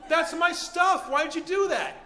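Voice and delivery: high, aggrieved acted complaint; wounded protest. Thief whose bag was kicked, high indignant protest. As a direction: protest as if you were the victim.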